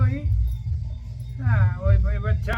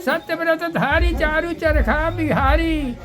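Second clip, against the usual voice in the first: raised, louder declamatory verse; poem recitation; 4 semitones higher, 4 dB louder than usual. Acted. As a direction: declaim it proudly like a stage poem. Grandpa recites verse on Gandhi. (ऊँचे स्वर में कविता पाठ)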